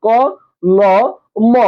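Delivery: ক, ল, ম each said alone with pauses between; letter by letter. Shown as a demonstration. Teacher showing students the letters in kolom, letter by letter. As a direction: sound out ক, then ল, then ম separately. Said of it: "যখন আমি উচ্চারণ করি".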